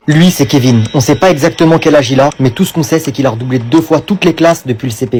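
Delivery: grave et puissante, façon trailer; voix de bande-annonce. Acted. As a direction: annoncer comme un film d'action. (voix off de film qui présente la classe)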